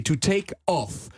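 "off" with a wide open O, said slowly; slow "take off" with the open O. Shown as a demonstration. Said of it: "prononcer ça avec un O ouvert".

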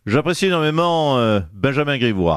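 low, put-on politician voice, hammed-up heartbreak; low mock-wounded lament; mock jilted politician. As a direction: play the betrayed boss in a low caricature voice. Same impersonated politician continues.

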